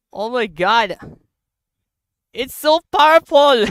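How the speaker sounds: loud, high excited gasps with laughter; can't believe how strong it is; amazed. Real, not acted. Gamer marvelling at the explosion, loud giddy excitement.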